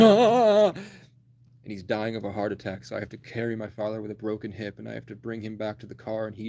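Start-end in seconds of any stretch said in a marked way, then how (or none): 0.0-0.7 loud, high imitated yell; dad's yell. Dad bursting in after the chase, loud mock yell. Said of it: "my dad comes bursting and goes".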